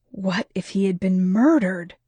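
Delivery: plain reading voice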